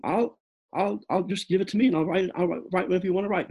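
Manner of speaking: high, fast, tumbling offer; eager rush. Retold or imitated. Young student begs professor to dictate, high and fast. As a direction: rush to help, words tripping over.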